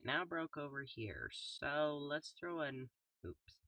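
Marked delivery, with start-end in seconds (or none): none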